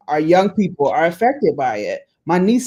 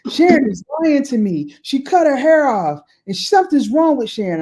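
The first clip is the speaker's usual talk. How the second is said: imitating niece; 8 semitones higher than usual. Niece telling other aunt.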